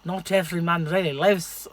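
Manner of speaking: movie line in a bad Scottish accent; a lightly put-on Scottish accent; attempted Scottish accent. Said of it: "Sorry to my Scottish people".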